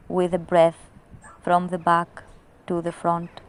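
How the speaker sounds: measured meditation guidance with long pauses; guided meditation. Acted. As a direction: lead them inward in even, deliberate phrases. Leads a recorded guided meditation.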